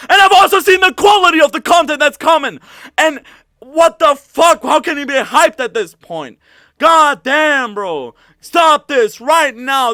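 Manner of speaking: furious yelling. Real, not acted. Streamer shouts at hyped fans and the developers in fury. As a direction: shout "stop this right now" at them. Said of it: "This is making me fucking angry".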